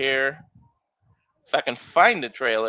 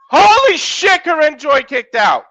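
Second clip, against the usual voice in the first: real shout, jumping much higher in pitch; astonished shout; 11 semitones higher, 6 dB louder than usual. Real, not acted. Commentator stunned when Corinne Joy kicks out, shocked shout. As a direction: blurt out a stunned holy shit.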